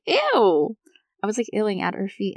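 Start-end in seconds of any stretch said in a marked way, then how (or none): 0.1-0.8 grossed-out ew. Retold or imitated. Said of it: "And I'm like".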